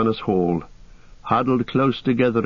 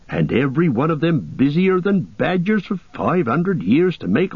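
higher homely voice with swooping questions; Sam, incredulous; 6 semitones higher than usual. Acted. Hobbit questions why dwarves dug it all, incredulous lilt. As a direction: marvel and puzzle, pitch leaping up.

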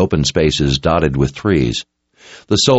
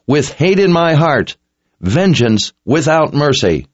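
higher-pitched, resolute vow; solemn battle pledge; 8 semitones higher than usual. A narrator's reading. Anson pledges vengeance with a fist to his heart, resolute. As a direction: swear it like an oath.

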